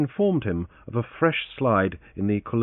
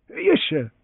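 valet voice; 6 semitones higher than usual. Acted. Valet answers.

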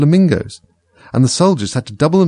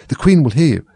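high, anxious warning; frightened warning; 6 semitones higher than usual. A narrator's reading. Rabbit warns Alice about the Queen, frightened. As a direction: warn as if afraid of being overheard. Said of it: "whispered in a frightened tone".

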